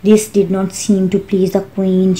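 ordinary delivery

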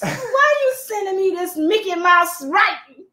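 high, heated retelling of a cranky man; retold angry rant. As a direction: play the annoyed caller scolding them. Rejecting man voiced in story.

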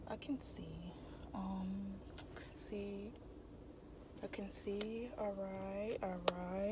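very soft, half-voiced self-talk; murmuring to oneself. Real, not acted. Vlogger adjusting herself in the car, murmuring to herself. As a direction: talk softly under your breath.

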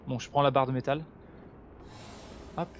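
ordinary talk

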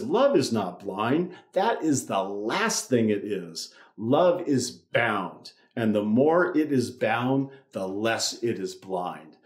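slow, deliberate reading of the quote; slow quoted line. Shown as a demonstration. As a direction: weigh each word of Chesterton slowly. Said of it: "And he says".

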